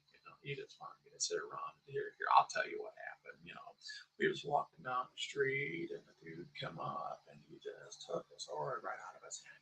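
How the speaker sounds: soft, near-whispered, mocking the calm tone people ask for; sarcastic hushed calm; mock chill voice. Shown as a demonstration. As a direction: tell the brutal story hushed and flat, as if it were nothing. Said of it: "people want me to talk like this".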